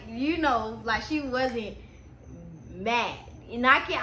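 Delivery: usual speaking voice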